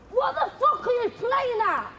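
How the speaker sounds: real, high-pitched angry outburst; angry confrontation. Real, not acted. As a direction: confront them furiously. Confronting partner in a real row.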